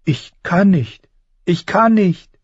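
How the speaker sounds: high-pitched, rising protest; Bran whining. A narrator's reading. Falling boy insists he cannot fly, whining. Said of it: "greinte Bran".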